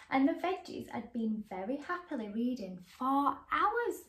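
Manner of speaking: put-on lively read-aloud to children; bright, animated storybook reading; story narration. A narrator's reading. Reading a picture book to young viewers, lively.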